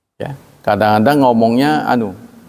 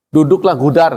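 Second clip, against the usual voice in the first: blunt order; 4 semitones higher than usual. Retold or imitated.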